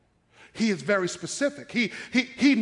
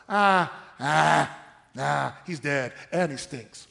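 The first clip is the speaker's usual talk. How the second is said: slow, low dismissive groaning doubt; doubtful Martha; 5 semitones lower, slower than usual. Acted. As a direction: wave it away as hopeless, dragging the words. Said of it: "That's where Martha was".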